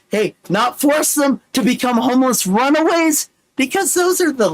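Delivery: high-pitched breakneck reading; Micro Machine Man. Acted. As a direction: push the words out high and urgently. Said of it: "read it like I'm the Micro Machine Man".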